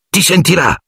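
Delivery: high, urgent frightened warning; terrified it will hear them; desperate warning. A narrator's reading. Harry warning Ron about the beast, high desperate urgency. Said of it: "gli intimò Harry disperato".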